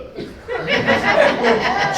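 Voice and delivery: silent high-pitched laugh; breathy high laugh. Real, not acted. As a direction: wheeze with laughter. Laughs at his own joke.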